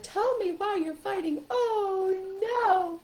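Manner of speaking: high, slow dismayed puppet; squeaky-high animal character; stuffed animal voice. Shown as a demonstration. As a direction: play a worried toy animal in a very high voice. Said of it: "You're changing your voice a little bit".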